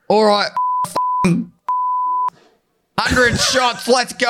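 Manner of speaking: rowdy party shout. Retold or imitated. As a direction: whip the crowd into a hundred shots. Drunk friend ordering shots, retold.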